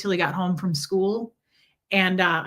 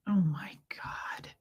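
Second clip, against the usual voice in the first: whisper; 10 dB quieter, slower than usual. Real, not acted. Emotional photos from her mom.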